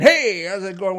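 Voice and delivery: mock overbearing hello; overpowering greeting. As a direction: blow them away with volume. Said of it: "blow them away by saying".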